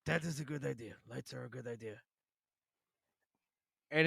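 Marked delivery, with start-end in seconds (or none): 0.1-2.0 mock menacing line. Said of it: "he goes, he goes".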